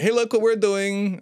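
high, slow mock Russian accent; bad Russian accent. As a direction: call it out in a hammy Russian accent. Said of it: "That was a bad Russian accent".

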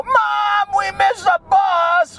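high-pitched whiny mock child; kids calling Mom. Kids who missed the school bus, mock high whine. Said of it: "they go".

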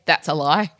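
ordinary talk, nothing marked

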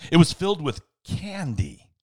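ordinary delivery

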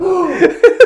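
big, high mock gasp; shocked gasp. Coworkers watching the blow-up, exaggerated shocked gasp. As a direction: gasp like a whole stunned crowd.

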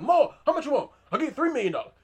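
high, loud mock-desperate offer; frantic haggling. Mayor trying to buy back the Sawyer house, mock frantic. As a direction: blurt out bids like a frantic buyer.

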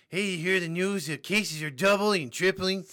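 loud, high imitation of an alarmed dad; riled-up dad. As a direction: shout it like dad relaying scary news. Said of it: "my dad tells me every".

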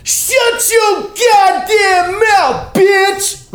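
very loud, high, drawn-out yell; full-voice imitation of an enraged man; screamed outburst. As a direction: scream it slowly, stretching every word. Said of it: "Like, that fucking loud".

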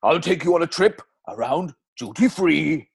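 lilting Welsh advert voice; Welsh accent. Acted. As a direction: Plainview with a Welsh lilt. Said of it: "that Welsh accent".

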